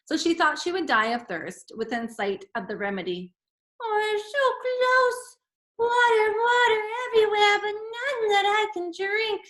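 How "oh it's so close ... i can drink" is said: mock despair.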